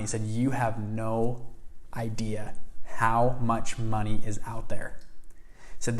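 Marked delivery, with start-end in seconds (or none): 0.2-5.0 slow, deliberate, spaced-out words; weighty retold assurance. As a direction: let each word land to correct a doubter. Mentor corrects his doubt.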